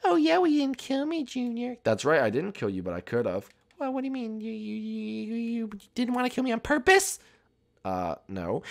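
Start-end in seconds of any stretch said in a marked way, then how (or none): 0.0-1.8 high, put-on girl voice; Inkling girl. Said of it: "Inkling girl". 3.8-7.2 put-on high, stammering girl voice; Inkling girl. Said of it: "Inkling girl".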